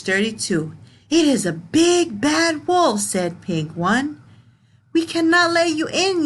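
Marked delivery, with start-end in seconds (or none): 1.1-3.0 alarmed pig. Acted. Pig One warning the other pigs, higher and alarmed. 4.9-6.2 higher, pushed yell from the pigs. Acted. Pigs yelling at the wolf, high mock yell. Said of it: "yelled the pigs".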